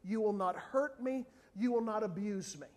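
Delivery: ordinary talk, nothing marked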